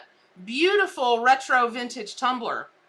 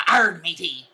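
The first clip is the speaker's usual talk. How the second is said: mock pirate; 7 semitones lower, slower than usual. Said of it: "I see pirates in my head".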